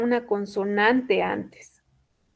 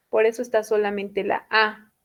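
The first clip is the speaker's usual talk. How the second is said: ordinary delivery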